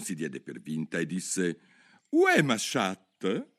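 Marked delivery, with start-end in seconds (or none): 2.1-3.5 "Où est ma chatte?" said slowly in careful French; schoolbook French. Acted. Alice tries her French phrase on the mouse, slow and careful. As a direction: recite the French textbook line. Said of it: "la prima frase del suo sussidiario di francese".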